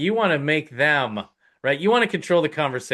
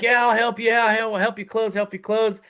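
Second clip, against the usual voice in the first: eager helper; 8 semitones higher than usual. Retold or imitated. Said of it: "you're like".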